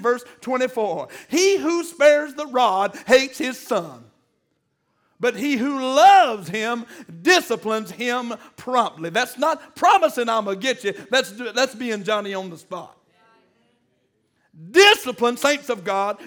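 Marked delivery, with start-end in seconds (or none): 1.3-9.1 slow, loud scripture reading from the pulpit; preaching scripture. Acted. Preacher reading Proverbs to the congregation, slow and loud. 14.7-15.4 one word thrown out loud; loud preaching. Acted. Preacher calls out to the saints, loud and emphatic.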